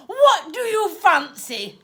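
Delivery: a sickly cheerful offer; aunt offering vile snacks. Acted. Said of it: "she asked cheerfully".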